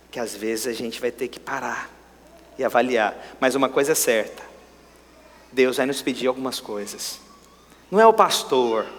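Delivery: ordinary talk, nothing marked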